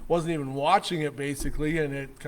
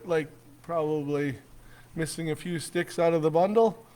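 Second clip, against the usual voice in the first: ordinary delivery, nothing marked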